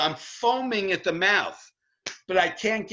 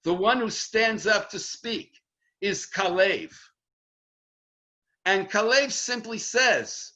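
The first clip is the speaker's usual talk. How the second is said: normal talking voice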